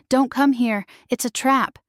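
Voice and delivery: ordinary delivery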